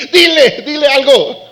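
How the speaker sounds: put-on childish demand; insistent child. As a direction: push someone to speak up, childish. David voiced as tattling child.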